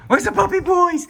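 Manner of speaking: high, sing-song dog talk. Real, not acted. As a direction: coo at the puppies. Greeting puppies, baby talk.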